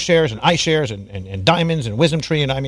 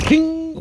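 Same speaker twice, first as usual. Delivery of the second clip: a sharp, very high vocal ping; imitated ping. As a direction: sing out a bell ping as a sound effect. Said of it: "I gotta find a sound effect".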